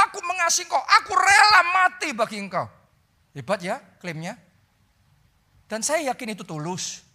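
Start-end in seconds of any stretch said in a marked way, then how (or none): 0.1-2.7 Peter's bold claim. Retold or imitated. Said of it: "Petrus berkata".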